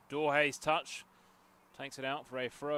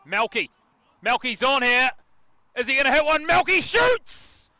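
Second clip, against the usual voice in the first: excited match call; 10 semitones higher, 14 dB louder than usual. Real, not acted. Commentator follows Melky to his shot, increasingly excited. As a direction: build from raised voice to high yell.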